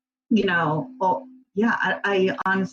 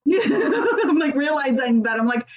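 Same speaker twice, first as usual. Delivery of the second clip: laughing while talking; 8 semitones higher than usual. Real, not acted. Laughing at her own admission.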